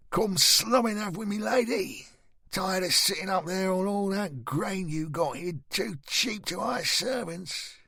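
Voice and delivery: high-pitched heckler in a crowd; jeering shout. A narrator's reading. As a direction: call out mockingly, pitched well above normal. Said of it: "Somebody else shouted".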